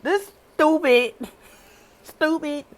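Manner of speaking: loud and high, stretching out stupid; outraged. Real, not acted. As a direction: spit out the insult in disbelief. Ranting at the king's choice.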